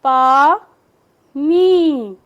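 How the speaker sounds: पानी broken into loud separate syllables. Shown as a demonstration. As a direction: sound out पानी piece by piece. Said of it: "या से हटके ई की". (पानी के हिस्से अलग अलग ज़ोर से)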